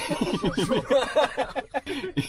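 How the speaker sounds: high breaking giggle. Real, not acted. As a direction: burst into giggles. Laugh breaks diary take.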